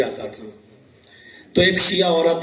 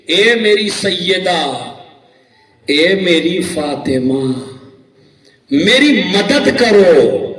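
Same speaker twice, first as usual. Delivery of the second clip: slow translation; 4 dB louder, much slower than usual. Shown as a demonstration. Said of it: "تسبیح کیا ہے؟".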